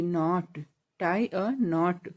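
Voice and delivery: very slow, each word drawn out; teacher saying it slowly so pupils can repeat; slow drill. Shown as a demonstration. Hindi teacher, to pupils, slow model phrase. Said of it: "के N O T".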